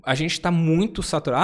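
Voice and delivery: ordinary delivery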